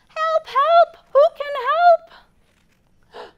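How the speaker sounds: high, loud little duck crying help; duck calling for help. Acted. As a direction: squeak out a panicky call for help.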